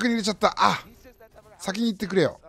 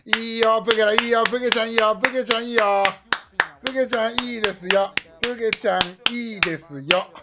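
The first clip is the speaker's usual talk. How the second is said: excited cheering; 5 semitones higher, 5 dB louder than usual. Real, not acted. Cheering the player on.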